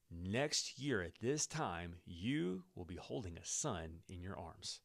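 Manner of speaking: slow it right down like a prophecy; slow, solemn promise. Acted. Prophet Elisha promising the woman a son, slow and solemn. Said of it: "and Elisha said to her".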